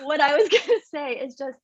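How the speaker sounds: laughing through tears; a tearful laugh that breaks into the words. Real, not acted.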